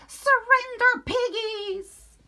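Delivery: high, loud commanding witch; triumphant witch shout. Acted. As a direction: bellow the order from above. Witch swooping on the pigs.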